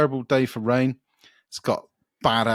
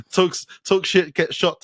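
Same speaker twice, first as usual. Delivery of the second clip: mock [name] shout; 7 semitones higher than usual.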